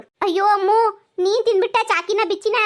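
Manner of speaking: high, loud dismayed cry of mock alarm; mock shock. Aunt teasing little girl who ate the dog biscuits, hammed-up shock. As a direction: overplay the horror to tease her. Teasing child about eaten chocolate.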